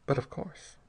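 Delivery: softly spoken assent; hushed, understated reply; quiet. A narrator's reading. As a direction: agree quietly, without fuss. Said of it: "Then he said quietly".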